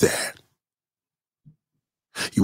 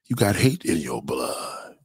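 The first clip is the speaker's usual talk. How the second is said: ordinary talk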